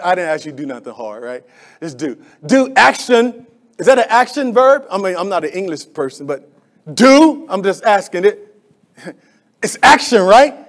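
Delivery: ordinary talk, nothing marked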